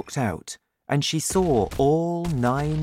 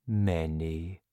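low, soft, downcast giant; sad answer; 6 semitones lower, 6 dB quieter than usual. A narrator's reading. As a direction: answer quietly and low, with sorrow. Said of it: "answered the B F G sadly".

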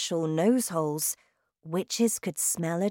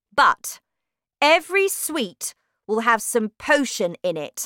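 shouting witch; 6 semitones higher, 7 dB louder than usual. Acted. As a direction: bellow like a terrifying witch queen. Witch explains her plan.

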